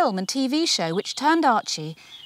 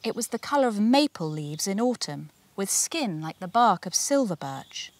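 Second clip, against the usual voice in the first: ordinary delivery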